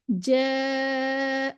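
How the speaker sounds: "जा" held very long with a thin, light vowel; slow, light, fronted alif in "जा"; बारीक अलिफ. Shown as a demonstration. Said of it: "बारीक पढ़ेंगे". ("जा" का अलिफ बारीक, लंबा खींचकर)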